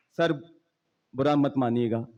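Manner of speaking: ordinary talk, nothing marked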